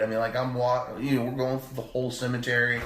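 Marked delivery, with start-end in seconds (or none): none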